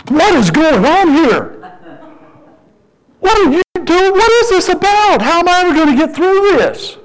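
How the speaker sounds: high-pitched ranting complaint, acted out; exasperated pleading. Overwhelmed father ranting to God in the car, exasperated. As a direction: demand answers from God, hammed-up despair.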